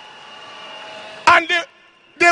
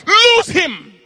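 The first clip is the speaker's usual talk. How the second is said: commanding shout; 6 semitones higher than usual. Retold or imitated. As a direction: cast it out with force. Repeated command to release.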